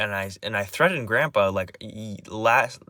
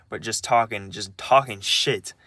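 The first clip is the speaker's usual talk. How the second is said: usual speaking voice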